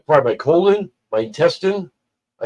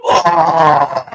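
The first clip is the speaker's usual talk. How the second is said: loud, high drawn-out "ah"; acted-out cry; 4 semitones higher, 6 dB louder than usual. Storyteller acting out own sudden reaction, loud drawn-out cry. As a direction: let out a long, raised cry. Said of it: "like that and started".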